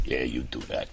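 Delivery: deep, soft put-on voice; low grumbling reply. A grumpy camper tells Ellis off, deep and low. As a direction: mutter it low and sour.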